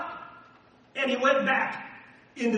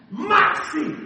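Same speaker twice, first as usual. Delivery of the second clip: much higher, louder rallying cry; shouted moxie; 10 semitones higher, 9 dB louder than usual. Real, not acted. Preacher to the church, a rousing shout of the keyword. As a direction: hit it like a battle cry. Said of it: "preacher's keyword shouted".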